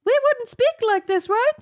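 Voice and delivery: very high head-voice speech. Shown as a demonstration. Coach showing how we wouldn't speak, head voice. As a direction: flip up high into head voice while talking. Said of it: "'Cause that would be head voice".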